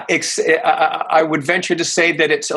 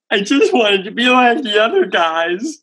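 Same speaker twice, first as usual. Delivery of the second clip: whiny; 6 semitones higher than usual. Retold or imitated. Whining like a kid.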